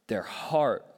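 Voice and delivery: whispered question. Real, not acted. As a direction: breathe it out as a hushed question. Tender close of sermon point.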